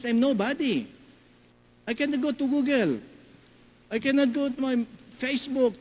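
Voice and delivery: mild imitation of a sobbing teen; crying teen. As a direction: voice a crying girl.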